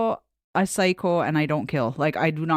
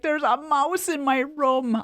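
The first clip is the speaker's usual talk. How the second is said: high, drawn-out mock panic; slow, high-pitched wail of alarm; retold panic; 9 semitones higher, slower than usual. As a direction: imitate the panicked daughter. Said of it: "I can hear the panic in her".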